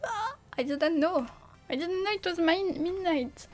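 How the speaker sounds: high, loud cry, then astonished; shocked realisation. Real, not acted. Streamer discovering it is midnight, shocked. Suddenly notices how late it is.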